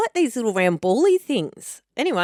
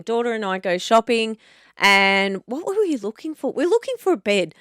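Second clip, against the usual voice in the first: ordinary talk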